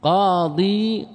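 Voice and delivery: قاضي stretched very slowly, "qaa-diii"; drawn-out final ya. Shown as a demonstration. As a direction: linger on both long vowels of قاضي. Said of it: "استثقلت الضمة على الياء".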